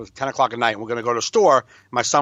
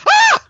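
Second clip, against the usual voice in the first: very high startled shriek; frightened scream; 10 dB louder than usual. Retold or imitated. Son screaming after the scare in the car. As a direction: jump out of your skin. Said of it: "And he was like".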